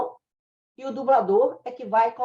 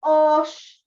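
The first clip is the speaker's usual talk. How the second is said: higher, louder Northeastern exclamation; Nordeste accent; 5 semitones higher, 5 dB louder than usual. Shown as a demonstration. Teaching regional flavor, a Northeastern Oxe. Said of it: "quiser falar assim".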